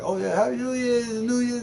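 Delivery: mocking high voice. Mocking people who celebrate New Year, high, silly voice. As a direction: parody the clueless New Year crowd. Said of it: "People are like".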